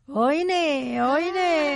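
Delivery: lightly hammed-up street call oine; dialect call. Imitating a Neapolitan calling to a girl. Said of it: "Hey, baby".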